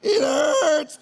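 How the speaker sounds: high, loud cry of pain; hammed-up howl of sore muscles; mock pained wail. Imitating wife in the shower after gym, mock pained wail.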